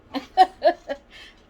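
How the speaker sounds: sudden high burst of laughter; short laugh. Real, not acted. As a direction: laugh it off, amused. Laughs at the late parcel.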